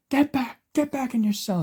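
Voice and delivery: high-pitched shouted command; yelling cop order. A narrator's reading. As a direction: order a prisoner back, yelling. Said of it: "As he's yelling".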